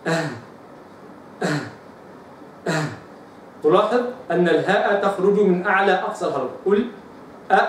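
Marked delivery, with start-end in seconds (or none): 0.1-2.8 آه said with a glottal stop then h; hamza demo. Shown as a demonstration. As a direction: repeat آه with a clear catch. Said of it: "فأقول". (نطق الهمزة بوضوح)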